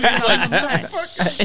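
high, laughing retold outburst; mock disbelief. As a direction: draw it out in amused disbelief. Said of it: "And he's like".

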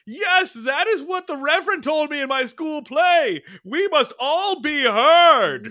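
high, very loud mock-theatrical proclamation; hammed-up shout to the back row, stretching the final words; booming stage declamation. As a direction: belt it out like an overacting school-play star. Acting out Aunt Bee's projected declamation.

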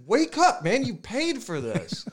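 mock-yelled waking of a sleeping buddy; urgent wake-up call. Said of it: "He was shaking me awake".

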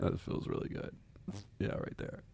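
near-whispered, matter-of-fact praise; quiet normal voice. Shown as a demonstration. Showing co-host how they talk in bed, quiet normal voice. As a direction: murmur it very softly, like a plain sentence. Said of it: "That's how I talk".

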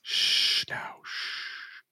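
whispered, barely voiced shush; soft hushing. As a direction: hush them very softly. Hushing an upset character.

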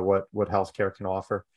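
ordinary talk, nothing marked

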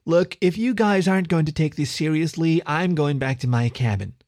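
put-on commanding girl voice. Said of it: "a rich female voice that commanded immediate attention".

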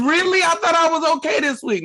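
high-pitched surprised patient voice; acted high, puzzled protest; confused patient. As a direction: squeak it out in baffled disbelief.

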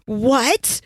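gasp, then a sharp high what; mock-startled what; surprised gasp. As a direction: caught off guard, gasping. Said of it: "I was all excited".

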